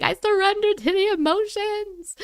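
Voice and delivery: high, hammed-up tearful wail; mock crying. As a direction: imitate yourself surrendering in tears. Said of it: "crying and being like".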